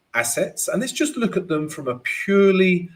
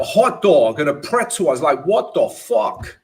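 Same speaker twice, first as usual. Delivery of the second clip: louder, hammed-up New York accent; 3 semitones higher, 6 dB louder than usual. Shown as a demonstration. Trader shows viewers his New York impression, hammed-up New York accent. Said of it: "this is my New York impression".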